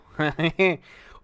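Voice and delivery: a short real laugh. Real, not acted. Laughs at own fix.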